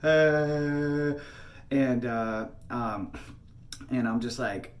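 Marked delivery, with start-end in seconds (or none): 0.0-1.1 long, drawn-out imitated child's wail; drawn-out mock crying noise; tantrum wail. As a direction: mimic a crying toddler's sustained "uhhh". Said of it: "She was temper tantrum".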